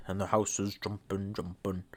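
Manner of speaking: low, very soft eerie repeat; mock spooky echo. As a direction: murmur it like a ghost. Repeating the game line again.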